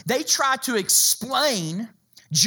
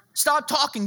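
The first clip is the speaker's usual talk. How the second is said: ordinary talk, nothing marked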